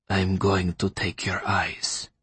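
slow, hushed, sinister doctor; soft, slow bedside menace; menacing near-whisper. A narrator's reading. Doctor's menacing bedside manner to captive.

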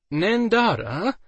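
drawn-out, slow, high nervous call; a hint of nerves in a slow high call. A narrator's reading. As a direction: warn her gently, uneasy. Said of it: "Aiden said nervously".

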